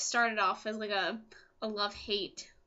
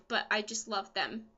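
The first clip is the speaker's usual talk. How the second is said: usual speaking voice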